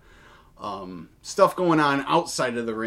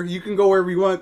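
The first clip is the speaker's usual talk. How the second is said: pleading; 6 semitones higher, 5 dB louder, much faster than usual. Retold or imitated. Company begging him to stay.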